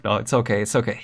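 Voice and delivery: usual speaking voice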